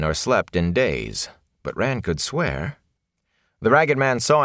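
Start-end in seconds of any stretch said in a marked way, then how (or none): none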